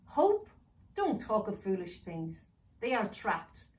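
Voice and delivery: villain mocking elf's hope; scornful villain. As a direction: sneer it down.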